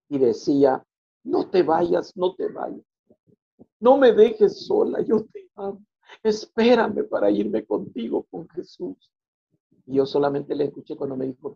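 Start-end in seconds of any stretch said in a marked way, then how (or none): 1.2-9.1 much higher, slow, begging; wife pleading. Retold or imitated. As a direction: plead like a desperate wife holding on. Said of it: "empezó a gritar y se oía como dos voces". (esposa suplicando con voz alta y angustiada)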